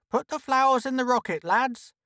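high, quick order; brisk high-voiced command; bossy woman character. Trixie giving orders to men.

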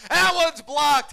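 live commentator's rising excitement; excited play-by-play. Real, not acted. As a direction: announce the big defensive play. Commentator calls a block live.

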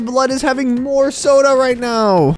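loud, incredulous outburst; exasperated shouting. Acted. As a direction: yell in outraged disbelief. Outraged at baby having more soda.

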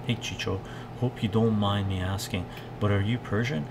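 ordinary talk, nothing marked

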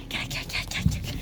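urgent hushed whisper; whispering while hiding. Pretend. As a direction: call them over in a whisper. Sneaking away while hiding.

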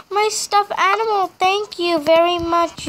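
put-on a kid thrilled to get her stuffed animal back; delighted gasp. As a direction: gasp and gush over the lost toy.

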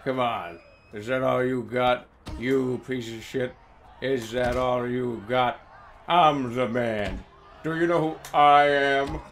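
low, slow macho swagger; boastful brawler taunt. Pretend. Low mock macho taunts voiced for his game fighter. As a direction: puff up like a meathead fighter.

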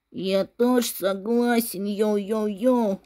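a rapper-type classmate chiming in, low and slow; cool dude boy. Acted. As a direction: drawl it like a too-cool kid.

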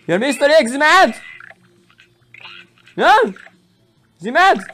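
high-pitched, teasing questions; mocking taunt. Player teasing an opponent about his egg, mocking taunt.

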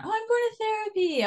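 high, breezy mock announcement. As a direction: toss it off lightly, high and casual.

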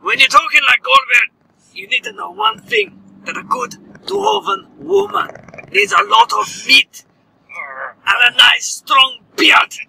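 put-on Scottish dwarf accent. Friend teasing about dwarf women, hammed-up Scottish accent. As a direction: lay it on thick as a boastful Scottish dwarf. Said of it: "wee lassie".

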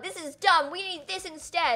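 high, mocking complaint; lightly voiced whiny gamer; mock whiny fan. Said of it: "they were all like".

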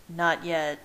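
ordinary delivery, nothing marked

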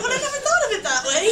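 loud, high-pitched incredulous realisation; mock shock. Telling mum the story now seems dangerous, mock shock. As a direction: blurt it out high and loud, hammed-up disbelief. Acted shocked reply to mum's revelation.

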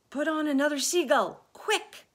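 loud, high order; pressing high-pitched command; urgent shout. A narrator's reading. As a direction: yell for quick action. Urgent orders during the rescue.

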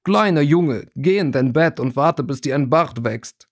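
put-on condescending older boy, thick Durmstrang accent. A narrator's reading. Vasili sending younger rival away, Durmstrang accent. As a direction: talk down in a heavy Durmstrang accent. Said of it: "mit schwerem Durmstrang-Akzent".

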